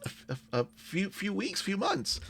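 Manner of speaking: put-on halting answer in a high girlish voice; lightly voiced unsure girl; girl character. Game master answers as young girl.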